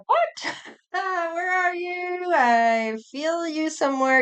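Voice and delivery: startled high "What?" then puzzled searching; surprised reaction. Retold or imitated. Reader imagining sensing a stranger's energy, surprised. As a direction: act amazed at sensing someone unseen. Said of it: "you're gonna be like".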